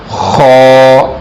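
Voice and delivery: خو rasped from the throat with force; throat sound. Shown as a demonstration. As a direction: scrape خو out of the throat. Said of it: "گلے سے نکالا جائے گا".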